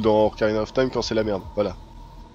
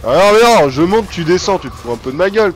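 higher, louder, exasperated protest; indignant outburst; 5 semitones higher, 9 dB louder than usual. Real, not acted. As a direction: protest loudly at the game. Protests at the game.

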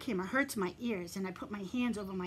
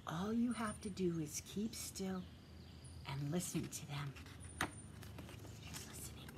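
whisper; 2 semitones lower, 8 dB quieter than usual. Real, not acted. Asks kids to keep still.

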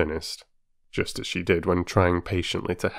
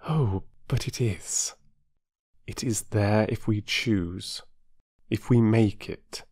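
gentle; 2 semitones higher, slower than usual. A narrator's reading. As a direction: ease the contradiction kindly. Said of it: "Then she said gently".

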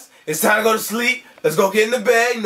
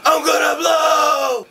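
about to explode; 6 semitones higher, slower than usual. Acted. Angry puppet about to explode.

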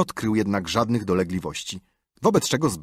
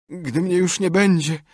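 high, pitiful last words; feigned dying; 8 semitones higher, 4 dB louder than usual. A narrator's reading. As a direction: speak as if fading away. Tomek's mock dying words.

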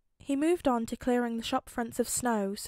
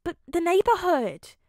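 higher, louder protest; 8 semitones higher, 8 dB louder than usual. A narrator's reading. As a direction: blurt it out in defence. Peter protests to Tony.

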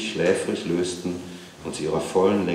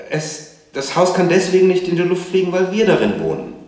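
loud, very high, insistent explanation; exasperated mother; 12 semitones higher, 8 dB louder than usual. A narrator's reading. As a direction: lay down the law, voice pitched way up. Mother loses patience with child.

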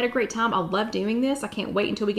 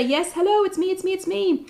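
higher-pitched mock-eager answer from a viewer; eager reply; 9 semitones higher than usual. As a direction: pipe up like an excited winner. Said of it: "Just say".

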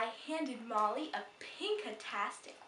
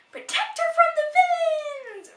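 heroic cry; 16 dB louder than usual. Acted. Heroic princess declaration.

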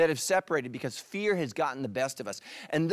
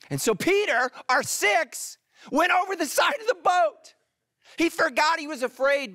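much higher and louder, triumphant build; soaring excitement; 12 semitones higher, 4 dB louder than usual. Acted. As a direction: lift them over the side of the boat. Climactic story moment.

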